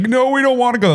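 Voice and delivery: put-on a reluctant kid refusing to go; whiny kid. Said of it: "we used to be like".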